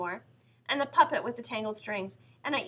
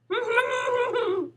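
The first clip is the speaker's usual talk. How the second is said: put-on much higher, louder gleeful little-girl laugh; little sister laughing; 13 semitones higher, 5 dB louder than usual. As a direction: let out a high mischievous giggle. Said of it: "said my little sister".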